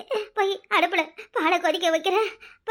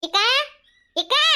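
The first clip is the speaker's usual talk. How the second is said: calling out; 5 semitones higher, 4 dB louder than usual. Acted. Visitor calls out for her.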